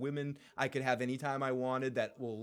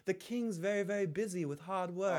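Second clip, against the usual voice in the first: lightly voiced Varys; 6 semitones higher than usual. Voicing Varys' excuses.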